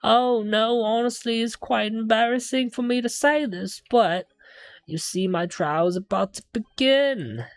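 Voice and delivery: eccentric game defendant. Acted. Client haggling with two students over payment, pompous and high. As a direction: put on an affected London accent. Voices defendant making request.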